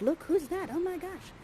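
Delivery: very soft, high excited aside; mock whisper. Spirit friend in Miranda's ear on spotting the prince, mock whisper. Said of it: "whispering in your ear like".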